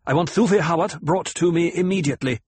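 urgent, imperious demand; snapped order. A narrator's reading. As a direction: snap out the order quickly. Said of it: "she snapped".

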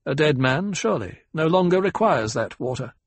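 lecturing. Acted. Ecologist corrects the banker on Fremen custom, lecturing. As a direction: instruct them point by point, firmly. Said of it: "spoke in a lecturing tone".

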